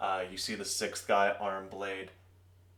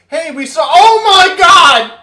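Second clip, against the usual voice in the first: screaming in horror, much higher and much louder; shocked scream; 24 dB louder than usual. Acted. Gear Boys opening the vault, screaming in horror. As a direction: shriek it like you just saw a massacre.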